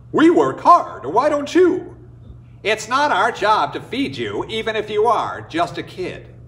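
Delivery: high, loud mocking scorn; scornful villagers. People mock the orphan boy, loud and scornful. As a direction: sneer down at the lazy kid. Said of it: "they said".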